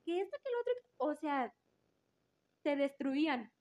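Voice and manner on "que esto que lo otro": much higher, louder gossip chatter; mock gossip. Mocking classmates badmouthing others, very high and louder. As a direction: rattle off the gossip in a silly high voice.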